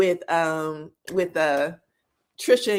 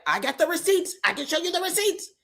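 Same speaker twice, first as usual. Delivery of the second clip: high, quick, insistent voice; protesting woman; 9 semitones higher than usual. Retold or imitated. Recapper mimicking Trisha defending herself, shrilly insistent. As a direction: pitch it up and plead the case fast.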